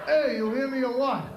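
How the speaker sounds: pushy Brooklyn street voice, lightly done; mock Brooklyn tough; Brooklyn tough guy. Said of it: "He actually spoke to me like this".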